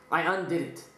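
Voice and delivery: usual speaking voice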